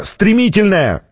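high, urgent warning cry; acted shout, volume held; alarmed shout. A narrator's reading. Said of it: "кричал кто-то".

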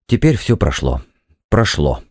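low, subdued reassurance; calming down. A narrator's reading. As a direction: let the temper drain, pitch sinking. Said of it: "Он перевёл дух". (успокаиваясь, низким голосом)

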